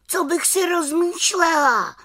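high, sharp exclaimed retort; indignant cry. Acted. Little witch cries out at the messenger, high and indignant. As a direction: fling the question back, outraged. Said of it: "Vykřikla malá čarodějnice".

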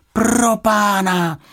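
high, loud, drawn-out cry of alarm; slow high yelp of dismay; startled exclamation. A narrator's reading. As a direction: cry out in shock, stretching it. Girl alarmed by falling drop.

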